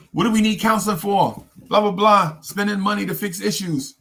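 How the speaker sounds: high, dismissive whiny imitation; mocking the audience. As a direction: sneer at the idea of counseling. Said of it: "I know what y'all gonna say".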